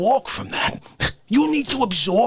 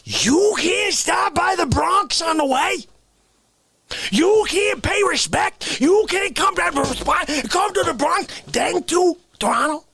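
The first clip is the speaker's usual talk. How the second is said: emphatic rule-laying; 9 semitones higher than usual. Acted. Laying down the road-trip rules.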